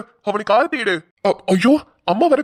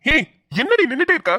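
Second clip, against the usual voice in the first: higher-pitched, impatient scolding; scolding order; 4 semitones higher than usual. Acted. Husband chides wife for standing idle, impatient scolding. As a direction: order her to get moving.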